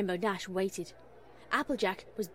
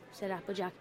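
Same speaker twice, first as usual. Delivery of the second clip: ordinary delivery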